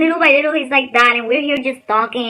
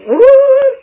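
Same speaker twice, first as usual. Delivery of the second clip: high, louder mock-ghost ooh; spooky ghost moan; 12 semitones higher, 8 dB louder than usual. Mocking the fear of ghosts, spooky ooh. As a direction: tease with a spooky wail.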